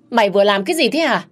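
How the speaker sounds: fast, loud, high demand; acted angry outburst; outraged shout. A narrator's reading. Outraged at wallet thrown into ditch.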